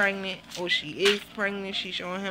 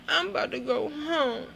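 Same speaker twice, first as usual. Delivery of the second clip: whiny mimic; 7 semitones higher than usual. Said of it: "She went from cracking the fuck up to".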